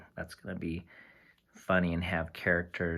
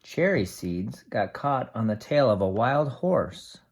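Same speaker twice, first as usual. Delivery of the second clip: steady storybook read-aloud for a class; reading to children. Acted. Teacher reading a tree book to the class, steady storybook voice.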